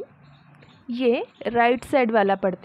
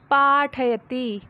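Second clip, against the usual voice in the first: slow model reading; 3 dB louder, much slower than usual. Shown as a demonstration. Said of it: "teacher reads Sanskrit slowly".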